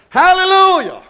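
high, loud hallelujah shout; preacher's hallelujah. Real, not acted. Preacher lifting the congregation, loud hallelujah. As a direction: cry out hallelujah.

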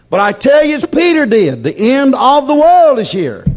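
high-pitched, forceful pulpit proclamation; emphatic preaching. Acted.

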